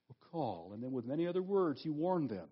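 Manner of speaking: normal talking voice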